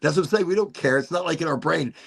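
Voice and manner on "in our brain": ordinary delivery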